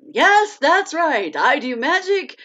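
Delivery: loud, high, drawn-out announcement; playful proclamation. Real, not acted. Host announces her talents to viewers, playful proclamation. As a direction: proclaim it big and high, with showy excitement.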